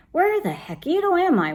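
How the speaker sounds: high, puzzled storybook exclamation; wondering out loud. A narrator's reading. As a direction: wonder aloud where on earth you are. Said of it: "wondered Scipito out loud".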